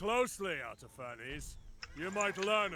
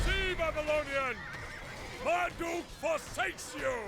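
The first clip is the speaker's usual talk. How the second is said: taunting shout; 5 semitones higher than usual. Acted. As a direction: jeer at the defenders from the walls. King taunting the enemy.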